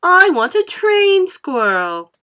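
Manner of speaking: slow, high, loud insistence; spoilt demand. A narrator's reading. Spoilt girl insists to Mummy, slow and loud. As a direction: drag out every word.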